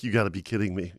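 low, quiet, disbelieving mutter; muttered disbelief. Retold or imitated. To himself, realizing he loaded the wrong trailer: stunned mutter. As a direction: say it under your breath, stunned.

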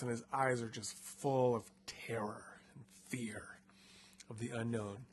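whispered campfire-story voice. A narrator's reading. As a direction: draw them in with a hushed telling. Spooky narration of terrified guide.

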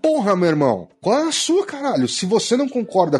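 exasperated outburst. Real, not acted. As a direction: blow up at the critic, pitch soaring.

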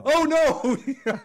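a loud, high, mock-horrified "oh no"; mock dismay. Real, not acted. Reacting to the riots story with loud mock horror. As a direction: cry out in playful dismay at the memory.

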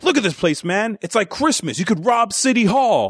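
high-pitched mock amazement; excited kid, imitated. Kid thief telling a cop about the looting, mock excited. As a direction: play a thrilled kid pointing out easy loot.

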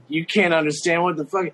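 ordinary delivery

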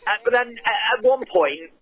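normal talking voice

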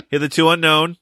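a loose Chicago-accent imitation of "Hitherto unknown"; mock Chicago accent. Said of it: "how would you say ... in a Chicago accent".

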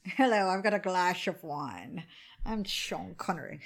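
lightly voiced, low Sean Connery accent with slushy s, glass as glash; a shaky stab at Sean Connery's Scottish slur; bad Sean Connery accent. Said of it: "that was a shitty accent".

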